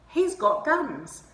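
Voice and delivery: high, loud and slow disbelief; incredulous. Real, not acted. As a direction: stretch it out in shock. Disbelief at armed guard.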